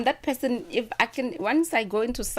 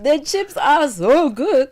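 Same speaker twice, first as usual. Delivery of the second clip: loud, slow, high gushing praise; excited rave; 7 semitones higher, 9 dB louder, slower than usual. Real, not acted. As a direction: gush over the food. Raving about the food.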